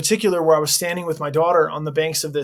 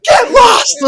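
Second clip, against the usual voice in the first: imitating a lady's shrill shout, much higher and louder; retold yell; 11 dB louder than usual. Imitating the old lady shouting at the chaplain, mock yell. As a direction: bark it out like an angry old patient. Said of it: "this lady was like".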